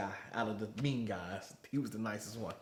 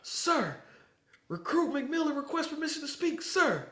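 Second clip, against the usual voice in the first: higher formal recruit voice; mock recruit request; 14 semitones higher, 3 dB louder than usual. McMillan asks drill instructor to speak, mock formal. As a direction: stand at attention and ask stiffly. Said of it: "he gonna step up and say".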